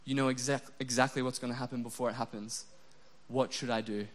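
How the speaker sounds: imagined morning prayer to God, hushed and humble; quiet prayer. Pretend. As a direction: pray it gently before work. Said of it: "and I said".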